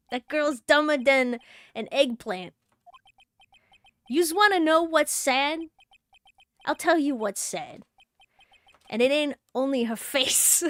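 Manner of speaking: put-on sneering New York wise-guy accent; mock her in an East Coast accent; put-on New York tough guy. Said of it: "love the East Coast accent".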